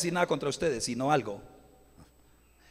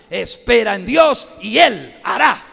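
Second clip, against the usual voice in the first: slow, loud declaration; preaching shout; 14 dB louder, slower than usual. Real, not acted. Preacher declaring trust in God to church, loud. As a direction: proclaim it to the rafters. Preacher quotes Bible forcefully.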